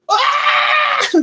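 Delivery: sharp gasp then a high wail; gasp and pained scream. Retold or imitated. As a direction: cry out under the belt. Getting whooped with the belt.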